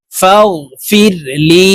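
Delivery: فاغفر لي with a drawn-out "lee"; one-alif stretch. Shown as a demonstration. Teacher demonstrating the short madd, stretched "lee" in لي. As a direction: chant it slowly and lengthen the vowel of لي. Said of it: "লি এখানে এক আলিফ টান হবে".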